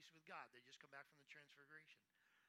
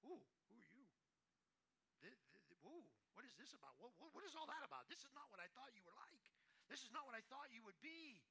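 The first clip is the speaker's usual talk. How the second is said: mock shocked stranger; 5 semitones higher than usual. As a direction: play a stranger caught off guard at the door. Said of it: "they open the door and like".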